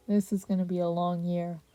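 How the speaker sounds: low, soft tired sigh; tired ghost sighing to the others. A narrator's reading. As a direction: sigh it out, weary. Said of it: "the third holder sighed".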